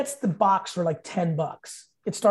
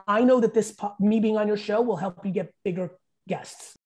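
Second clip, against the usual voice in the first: usual speaking voice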